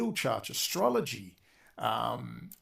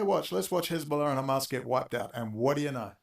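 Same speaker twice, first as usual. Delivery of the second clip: normal talking voice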